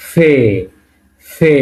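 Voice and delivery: "ফে" starting like Bengali ফ; "ফে" with ফ sound and held e; Russian letter demo. Shown as a demonstration. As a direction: say "ফে" slowly as a model letter name. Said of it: "ফ এর মতো".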